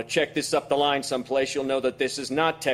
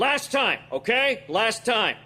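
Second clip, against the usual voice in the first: sharp warning; 9 semitones higher, slower than usual. Acted. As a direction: give your last warning with force. Agent's final warning.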